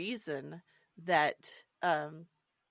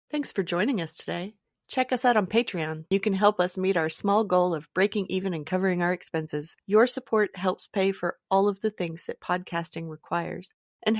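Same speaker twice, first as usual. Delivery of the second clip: louder, upbeat sign-off pitch; podcast outro read; 9 dB louder than usual. Acted. Host thanking listeners and asking for support, upbeat outro read. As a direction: sell the Patreon like an announcer.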